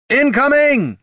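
high, loud yell; yelled warning. A narrator's reading. Bard to comrades as the bag swings, yelled warning. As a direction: yell it out to warn the party. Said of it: "yelled Heraldon".

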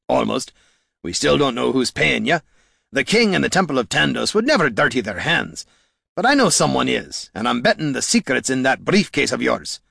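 firm dwarf voice with dialect; plain-dealing dwarf. Acted. Gorm to the Mask, frank dwarf voice. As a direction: speak as a dwarf confronting an old foe.

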